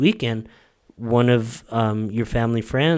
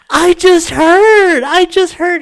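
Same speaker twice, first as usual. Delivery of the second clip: very high, loud squeal; bursting-with-news mock shriek; excited coworker; 14 dB louder, slower than usual. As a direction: squeal the news, drawing each word out. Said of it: "what are you excited about".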